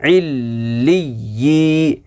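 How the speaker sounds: इल्ली यी slow, doubled l and y held; firm tashdeed. Shown as a demonstration. Teacher showing pupils the firmness, इल्ली यी with doubled consonants. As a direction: lean into the l and y of इल्ली यी. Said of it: "सख्ती के साथ पढ़ा जाता".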